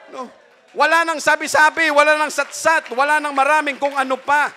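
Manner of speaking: loud, high, fervent preacher's cry; full-throated sermon climax; preaching at full force. Acted. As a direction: proclaim it to the congregation.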